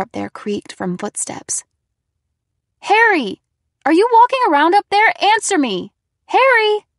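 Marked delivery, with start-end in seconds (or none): 2.8-6.8 loud, high, fast panicked calls; frightened shouting. A narrator's reading. Scared narrator shouts up at the footsteps, panicked. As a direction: yell up the stairs in rising fear. Hearing footsteps, calling frantically.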